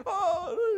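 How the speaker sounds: loud, high mock cry; drawn-out imitated wail of fright; scared wail. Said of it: "you know, they're scared".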